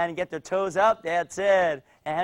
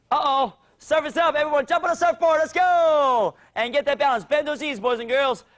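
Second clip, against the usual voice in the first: high-pitched, urgent play-along calling; excited rallying shout; 9 semitones higher, 3 dB louder than usual. Pretend. Coach tells kids surf is up, excited shout. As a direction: whip the kids into action. Leading pretend surfing game.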